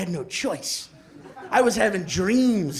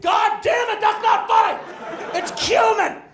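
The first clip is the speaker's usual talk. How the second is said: high-pitched acted furious yell; mock roommate blow-up, voice shooting up; 13 semitones higher than usual. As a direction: yell it at the top of the range. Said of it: "And he's yelling".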